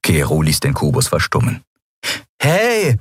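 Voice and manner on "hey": put-on much higher, young protesting voice; Miko protesting. Miko objects to the cube being switched off, high protest. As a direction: object like a kid cut off. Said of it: "protestierte Miko".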